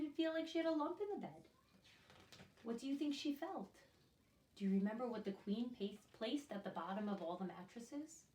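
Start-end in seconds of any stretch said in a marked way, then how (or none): none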